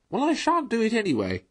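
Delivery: high, loud, excited toad voice; excited cry. A narrator's reading. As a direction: blurt it out, worked up. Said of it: "cried Toad, getting excited".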